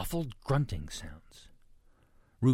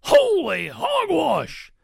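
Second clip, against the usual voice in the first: slow, high, huge bellow; 14 dB louder, much slower than usual. A narrator's reading. Biggest pig reacts to the gerbil, bellowing. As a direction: bellow like an enormous hog. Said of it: "bellowed the biggest enormous pig".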